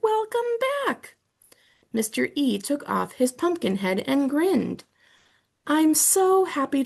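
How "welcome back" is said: very high squeaky call; hamster shouting. Acted. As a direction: squeak it from the top of the cage.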